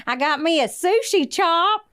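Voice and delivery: put-on high, slow West Virginia accent. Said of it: "with her West Virginia accent".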